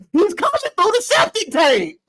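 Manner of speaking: high-pitched, laughing exclamation; laughing hard. Real, not acted. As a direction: crack up while blurting it out high. Cracking up at his own absurd scenario.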